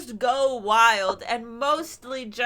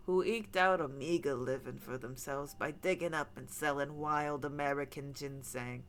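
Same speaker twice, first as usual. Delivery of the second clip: lower, softer storyteller read; story narration; 7 semitones lower, 6 dB quieter than usual. Acted. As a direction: read it like a campfire tale. Reads a spooky folklore segment.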